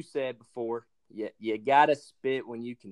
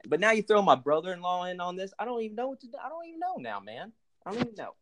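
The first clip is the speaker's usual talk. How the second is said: mock exasperation; 8 semitones higher, faster than usual. Guest pretending outrage at the hosts, pitch soaring up. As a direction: voice climbing higher and higher in hammed-up disbelief.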